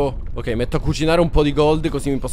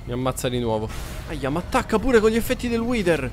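normal talking voice

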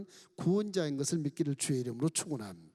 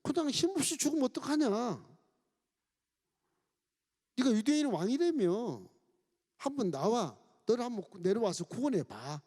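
higher, taunting robber's jeer; jeering mockery; 6 semitones higher than usual. Left thief taunting Jesus on the cross, higher mocking jeer. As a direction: taunt him to come down and save himself. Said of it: "이렇게 막 조롱을 막 하는 거예요".

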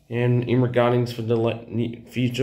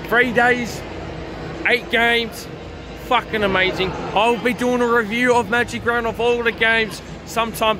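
excited shouting; 11 semitones higher than usual. Real, not acted. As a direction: still yelling with excitement. Still buzzing after the match.

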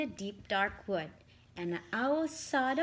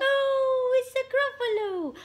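much higher, crying out in fright; terrified cry; 12 semitones higher, 4 dB louder than usual. Acted. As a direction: shriek at the monster. Mouse meets gruffalo, cry heard.

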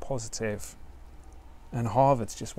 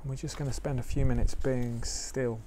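guided meditation; 4 dB quieter than usual. Acted. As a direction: ease listeners into stillness gently.

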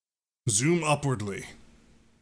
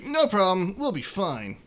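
higher, louder cheerful creature; high upbeat Mudkip; 8 semitones higher, 6 dB louder than usual. Acted. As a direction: chirp it like a confident little Pokemon. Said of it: "Mudkip's like".

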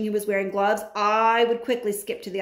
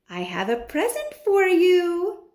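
high, slow, rasping witch offering a gift; creepy witch voice; 10 semitones higher, 4 dB louder, slower than usual. A narrator's reading. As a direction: lure the boy with a sly, high rasp. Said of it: "Her voice had a curious little rasping quality".

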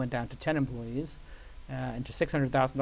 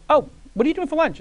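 much higher, breezy invite; high and casual, like a rich host; mock Barry; 13 semitones higher, 9 dB louder than usual. Barry inviting the young employee to lunch, breezy high voice.

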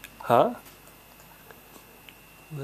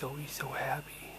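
whisper; 12 dB quieter than usual. Real, not acted. Marveling softly at the baby.

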